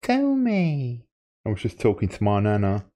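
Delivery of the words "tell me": very high, slow, drawn-out begging; silly high pleading. Pretend. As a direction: whine it in a squeaky high voice. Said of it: "Why are you talking like that?".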